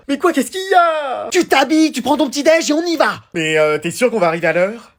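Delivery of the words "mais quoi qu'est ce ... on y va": high, insistent parent; exasperated ordering. Acted. Mother hurrying her late son, exasperated. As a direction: order him along at a raised pitch.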